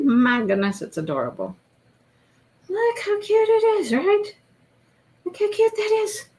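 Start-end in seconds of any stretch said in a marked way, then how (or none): 2.7-6.2 high, gushing coo over a cute little hat. Real, not acted. Crafter cooing over her tiny finished hat.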